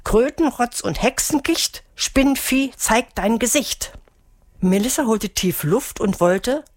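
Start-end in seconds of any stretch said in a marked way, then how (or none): 0.0-3.9 high, rhythmic incantation; witch's spell in a raised pitch; spell casting. A narrator's reading. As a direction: chant it like a magic rhyme, slowing at the end. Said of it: "mit einem Zauberspruch".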